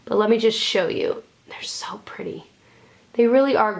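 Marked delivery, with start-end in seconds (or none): 1.5-2.4 hushed, voiceless admiration; awed whisper. Real, not acted. Vlogger showing off gorgeous eyeshadows, awed whisper. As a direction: whisper it, much softer than usual.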